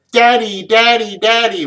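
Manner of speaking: high, loud imitation of excited children; little kids calling Daddy. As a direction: call out like an eager small child. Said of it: "we hear".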